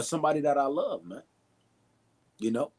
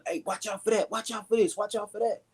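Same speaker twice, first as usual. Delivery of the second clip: quick repeated warnings, pitched up; mock screamed warning; 6 semitones higher than usual. Watchman warning people of danger, high mock yell. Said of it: "you kinda start screaming and telling".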